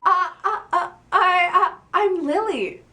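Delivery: high, trembling stammer, almost crying. Acted. Fan girl stammering her name at Louis, near tears. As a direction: stumble over every word in a high teary voice. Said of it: "Lily stammered".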